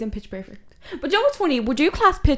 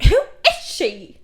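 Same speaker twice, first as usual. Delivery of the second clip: mimicking friend; 5 semitones higher, 6 dB louder than usual. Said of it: "she was like".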